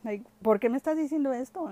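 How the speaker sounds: mock-shocked, asking why he says this; retold disbelief. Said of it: "And I'm like".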